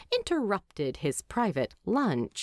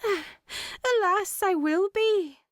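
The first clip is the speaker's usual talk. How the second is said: higher, sighing dwarf lady; 13 semitones higher, slower than usual. A narrator's reading. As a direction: sigh out a mock lament. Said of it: "Freya sighed".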